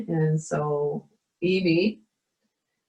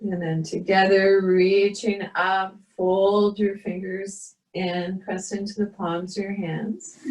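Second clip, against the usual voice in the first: guided meditation; 2 semitones higher than usual. Acted. Leads a yoga meditation.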